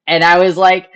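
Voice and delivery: usual speaking voice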